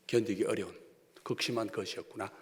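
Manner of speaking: usual speaking voice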